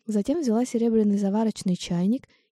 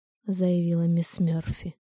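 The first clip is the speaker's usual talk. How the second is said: ordinary delivery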